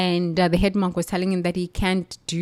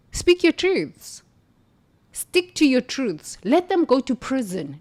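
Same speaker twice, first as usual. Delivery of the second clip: a rallying sermon cry to speak out; rousing call; 5 semitones higher than usual. Real, not acted. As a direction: preach it, lifting each word high.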